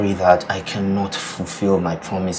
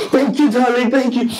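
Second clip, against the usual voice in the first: higher and louder, gushing; overjoyed thanks; 6 dB louder than usual. Acted. Man thanking his fiancée, higher, louder gushing. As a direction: gush your thanks at her.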